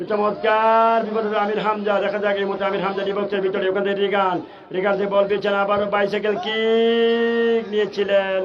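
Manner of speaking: high, urgent play-by-play as the attack builds; frantic football commentary. Real, not acted. Attack builds near the goal.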